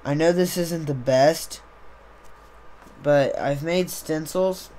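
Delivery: ordinary delivery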